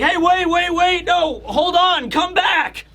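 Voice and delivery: high, shouted pleading; calling after. Retold or imitated. The voice calling Road back, high shouted plea. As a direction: yell to stop someone running off. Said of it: "the voice is like".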